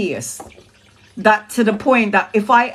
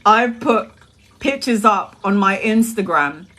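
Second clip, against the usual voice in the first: normal talking voice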